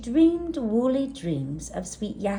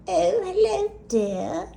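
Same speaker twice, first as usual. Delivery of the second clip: granny voice; 10 semitones higher, 4 dB louder than usual. Acted. Granny Hilda greets Gertie.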